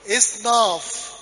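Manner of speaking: drawn-out إصناف, each sound spelled out slowly; slow syllable by syllable. Shown as a demonstration. Teacher spelling a Coptic word to class, slowly drawn out. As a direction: stretch إصناف out so the class can follow. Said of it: "يبقى إيه؟".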